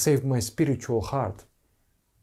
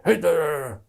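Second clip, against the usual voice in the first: mock motherly scolding, higher and louder; sudden high scolding call, put on; 6 semitones higher, 5 dB louder than usual. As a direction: scold like a mother telling off a child. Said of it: "sometimes scolding the, the, the, the mother scolds, like".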